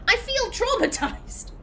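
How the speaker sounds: exaggerated jokey breakdown; mock traumatized Naomi. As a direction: overplay the trauma for laughs. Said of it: "she was just like".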